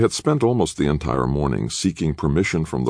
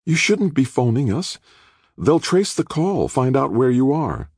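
higher, worried and urgent; alarmed gasp; 12 semitones higher than usual. A narrator's reading. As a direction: warn him in a breathless rush. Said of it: "Rachel gasped".

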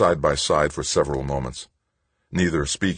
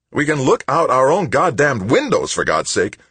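angry retort; 10 semitones higher, 7 dB louder than usual. A narrator's reading. Son snaps back at mother.

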